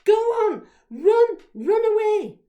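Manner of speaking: full-voiced high yell; very loud, high, urgent shout. A narrator's reading. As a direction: yell urgently to get a friend moving. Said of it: "shouted even louder".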